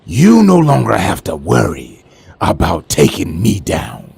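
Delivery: ordinary talk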